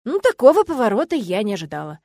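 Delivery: ordinary delivery, nothing marked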